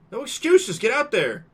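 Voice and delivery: high, loud scolding command; stern order. Acted. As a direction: drive him out onstage. Principal orders Ben out.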